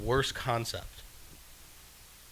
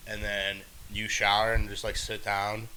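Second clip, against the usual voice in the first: normal talking voice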